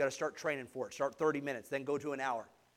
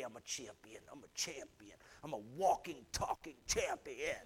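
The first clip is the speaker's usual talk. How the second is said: low soft self-talk, then near whisper; murmured mantra; 3 semitones lower, 5 dB quieter than usual. Acted. Pastor's daily self-talk, murmured low and soft. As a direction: mutter it to yourself like a mantra.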